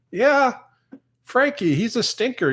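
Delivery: affectionate high pet voice; fond high voice. Real, not acted. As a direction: coo over Frankie like a pet-talker. Fond talk about cat Frankie.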